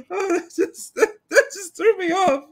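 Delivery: laughing. Real, not acted. Laughing after being caught off guard.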